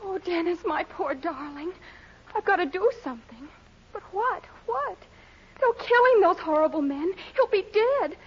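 high-pitched melodramatic worry; distraught fretting. Acted. Woman alone fearing for kidnapped Dennis, distraught fretting.

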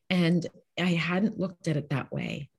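normal talking voice

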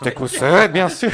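loud, hammed-up Belgian French accent. Shown as a demonstration. Host jokes about the chief's name in a Belgian accent. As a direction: belt out Tecumseh in a mock Belgian accent. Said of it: "avec l'accent belge".

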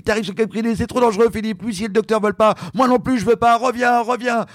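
high, pleading warning to come back; coach's warning. Retold or imitated. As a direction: voice the worried coach. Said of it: "Valérie me dit".

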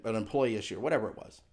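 normal talking voice

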